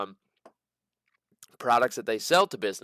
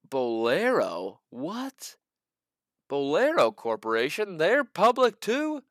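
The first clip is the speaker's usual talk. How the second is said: surprised; 5 semitones higher, slower than usual. Real, not acted. Surprised a bowling chain is public.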